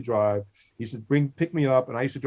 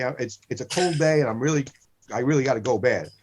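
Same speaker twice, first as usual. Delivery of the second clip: ordinary talk, nothing marked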